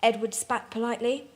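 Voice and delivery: ordinary delivery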